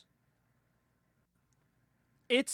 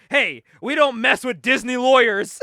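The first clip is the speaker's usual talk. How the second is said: loud, raised-pitch warning; mock scolding; 5 semitones higher, 10 dB louder than usual. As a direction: act out a blustering boss laying down the law. Boss rebukes his assistant.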